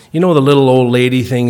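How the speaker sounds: ordinary delivery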